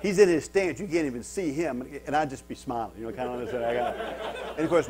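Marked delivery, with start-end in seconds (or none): none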